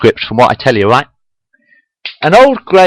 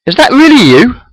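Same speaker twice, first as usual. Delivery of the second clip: amazed; 11 semitones higher, 4 dB louder than usual. A narrator's reading. Kirk amazed at old friend.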